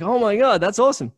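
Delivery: high, loud delighted exclamation. Retold or imitated. Reacting to meeting a listener, high and delighted. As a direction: exclaim with joy.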